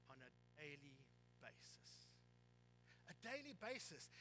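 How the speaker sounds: normal talking voice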